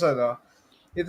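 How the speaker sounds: ordinary talk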